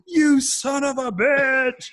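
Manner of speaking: slow, high, loud hammed-up curse; mock outrage. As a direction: drag out the curse in fake fury. Contestant cursing at challenge.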